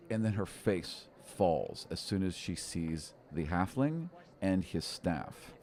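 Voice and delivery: normal talking voice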